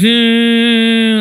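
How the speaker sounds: drawn-out mock hum of refusal; closed-mouth child's hum. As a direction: imitate a child refusing to open up. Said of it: "like that".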